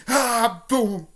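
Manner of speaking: loud, high cry with an impact noise; hammed-up fight sound effect; mock attack yell. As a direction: yell out a play-acted punch. Acting out Naruto's attack.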